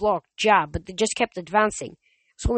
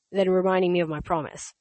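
ordinary delivery, nothing marked

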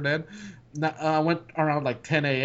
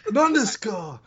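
lightly voiced anime character; a hint of an anime hero; 5 semitones higher, 3 dB louder, slower than usual. Shown as a demonstration. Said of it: "here's how you do anime sounds".

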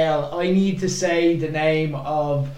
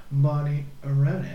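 low, softened podcast host impression; James Richardson; 5 semitones lower than usual. Saying the name under a mask on the bus, lightly voiced impression. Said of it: "in the voice of".

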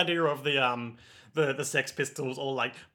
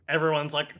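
ordinary delivery

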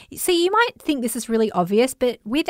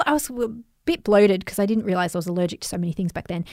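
ordinary talk, nothing marked

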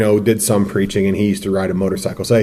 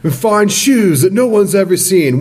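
high, slower, sulky schoolboy; deflated child voice; 9 semitones higher, slower than usual. Retold or imitated. Schoolboy scales down his dream for the teacher, high mock-sulk. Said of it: "Izzard has a great bit".

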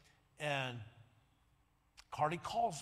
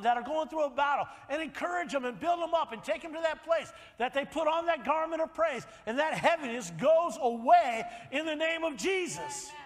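loud, high revival preaching; preaching at full force; 9 semitones higher, 8 dB louder than usual. Acted. Preacher rousing the congregation, loud and high. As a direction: drive it home to the church.

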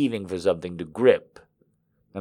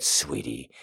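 low whispering man; 11 semitones lower, 11 dB quieter than usual. Acted. Male character low.

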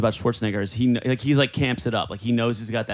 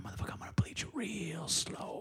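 whispered movie-villain threat impression; Bill Duke; 8 semitones higher, 17 dB quieter than usual. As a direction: drop into a menacing whisper. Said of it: "working on my Bill Duke impression".

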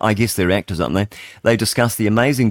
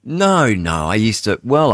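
ordinary delivery

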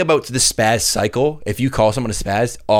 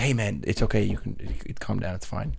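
softer, reassuring calm-down; soothing; 4 semitones lower, 10 dB quieter than usual. Retold or imitated. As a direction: gently settle someone. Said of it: "if someone was like".

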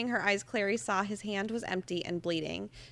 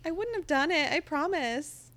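mock innocent promise; 6 semitones higher than usual. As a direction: sweet-talk like a liar. Mocking Valentine's promise.

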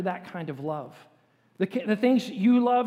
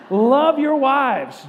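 loud and slow, every word drawn out; slow booming read; 3 semitones higher, 8 dB louder, much slower than usual. Shown as a demonstration. Pastor leads the men reading the verse aloud. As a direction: lean hard into each word. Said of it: "say it with a, a really nice, deep voice".

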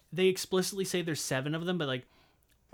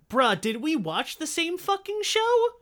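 exasperated disbelief; 11 semitones higher, 6 dB louder, slower than usual. Retold or imitated. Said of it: "I'm like".

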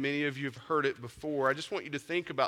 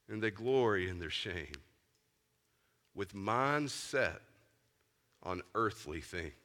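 scripture reading; 5 semitones lower than usual. Shown as a demonstration. Preacher reading Philippians to his church, solemn and fading low. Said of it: "let me read it to you".

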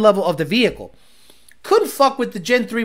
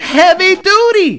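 higher, louder, drawn out slowly; mock announcer voice; 8 semitones higher, 6 dB louder, slower than usual. Streamer mimicking the clip's heavy duty line, slow and loud. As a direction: belt it like an announcer.

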